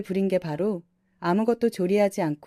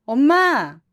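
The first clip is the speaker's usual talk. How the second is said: loud, higher cry of 엄마; angry shout; 5 semitones higher, 7 dB louder, much slower than usual. Acted. 남편이 어머니에게 참다못해 외치는 큰 소리. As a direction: shout to cut her off. Husband shouts at his mother.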